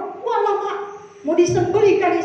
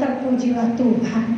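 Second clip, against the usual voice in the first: low-pitched, deflated retold prayer; resigned mock praise; 6 semitones lower than usual. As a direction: drop low, as if giving in. Patient's resigned praise after refusal.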